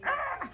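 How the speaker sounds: short, high exasperated "ah"; frustrated mock scream. Partner triggered in a spat with boyfriend, mock frustrated scream. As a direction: imitate a triggered outburst in one burst. Imitates couple both getting triggered.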